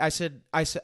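ordinary talk

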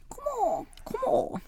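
squeaky high mascot cry. Acted. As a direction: chirp it like a cute mascot.

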